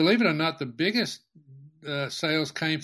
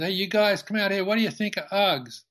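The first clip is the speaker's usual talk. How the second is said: raised, higher voice calling to the back of the shop; buddy calls the grommets out, a raised higher shout; 5 semitones higher than usual. Retold or imitated. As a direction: call the kids out from the back room. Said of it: "he calls out".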